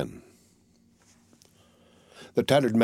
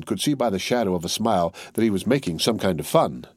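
usual speaking voice

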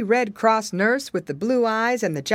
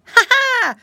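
high, loud "ha ha"; a bright burst of monkey laughter, acted out; mock laugh; 10 dB louder than usual. A narrator's reading. Hidden monkey surprising the piggy boy, high acted laugh. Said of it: "laughed a voice behind him".

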